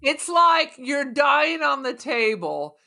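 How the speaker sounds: normal talking voice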